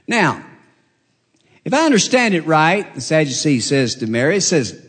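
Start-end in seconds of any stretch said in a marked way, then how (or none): none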